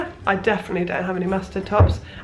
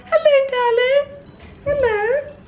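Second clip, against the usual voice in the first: talking to cat; 14 semitones higher than usual. Real, not acted. Greeting her cat Hobbs, high cooing baby talk. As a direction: coo hello to the cat.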